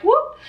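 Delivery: quick high-pitched "whoop" for a shape; imitated whoop. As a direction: let a little whoop leap up high. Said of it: "His head was like".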